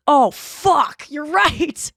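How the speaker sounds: loud, high retold shock; stunned realization. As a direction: blurt it as the truth suddenly hits.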